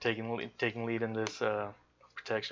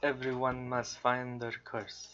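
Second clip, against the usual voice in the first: slow, softer put-on accent; Symmetra; 7 dB quieter, slower than usual. Player shows friends a similar character, slow accented impression. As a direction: imitate a game heroine's accent, slow and soft. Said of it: "that's close to Symmetra".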